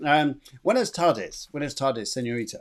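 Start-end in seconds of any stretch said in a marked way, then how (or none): none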